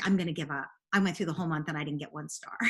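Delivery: low, quick, defeated parent; lightly voiced dejection, dropped pitch; discouraged parent. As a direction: voice a parent ready to quit. Voicing parent about to quit.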